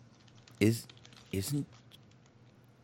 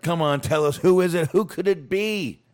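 high, rising, louder coaxing; teasing prompt; 11 semitones higher, 4 dB louder than usual. Real, not acted. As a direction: needle him to answer. Teasing co-host for answer.

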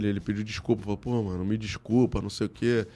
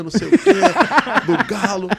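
laughing; 8 semitones higher, 8 dB louder than usual. Real, not acted. Recalling chaos in rival crowd.

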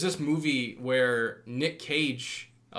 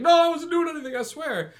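much higher, loud frightened plea that fades; pleading kid; 6 dB louder than usual. Retold or imitated. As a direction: squeal it out like a scared kid begging.

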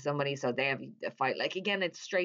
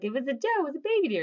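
high, louder mock fluster; hammed-up panicky cover story; flustered blurting; 11 semitones higher, 4 dB louder, faster than usual. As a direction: blurt the excuse high, imitating a bad liar. Retold flustered cover story to cops.

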